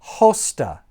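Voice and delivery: "Hosta" with h before a long o; rough breather. Shown as a demonstration. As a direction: demonstrate "Hosta" as HOH-stah, falling. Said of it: "rough breather on this omega".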